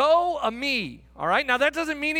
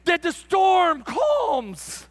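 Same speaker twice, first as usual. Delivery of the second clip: loud, raised-pitch preaching fervour; really excited; 4 semitones higher, 4 dB louder than usual. Real, not acted. Preacher to congregation about Jesus in the storm, really excited. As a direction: proclaim it with mounting excitement. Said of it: "I'm getting really excited".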